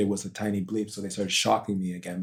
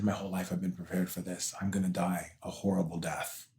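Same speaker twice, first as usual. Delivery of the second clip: hushed whisper; 7 dB quieter than usual. Retold or imitated. Retold dying words.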